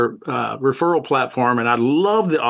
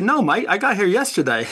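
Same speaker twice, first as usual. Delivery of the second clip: higher-pitched Aussie punchline; Australian accent; 6 semitones higher than usual. Acted. Aussie soldier answers back in the joke, high Australian accent. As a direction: deliver the punchline as a joking Aussie. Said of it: "you got that accent down really good".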